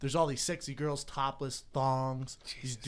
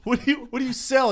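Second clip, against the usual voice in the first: higher, louder mock hostess; mock female host; 8 semitones higher, 7 dB louder than usual. Comedian imitates the host quizzing the guy, higher and louder. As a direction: play the teasing show host. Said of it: "she goes".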